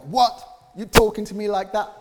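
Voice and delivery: loud, high indignant snap back; mock furious comeback at someone rude; angry retort. Pastor acting out snapping at a rude person, loud and high. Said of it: "I respond in anger and I'm like".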